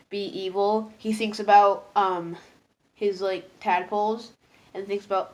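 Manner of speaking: ordinary talk, nothing marked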